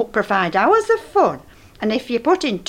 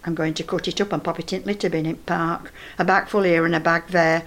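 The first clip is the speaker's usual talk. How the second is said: ordinary talk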